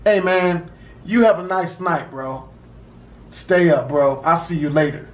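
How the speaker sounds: a deceptively sweet see-you-later; smiling farewell. Retold or imitated. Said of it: "dude just looks at me and smiles".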